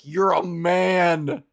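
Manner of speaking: a lightly voiced transphobe declaring the obvious; mock bigot. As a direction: deliver it as a flat, drawn-out verdict. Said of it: "they're like".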